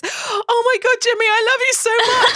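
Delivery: a mock-hysterical fan squeal at Jimmy; starstruck gush. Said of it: "all I can think of to say is".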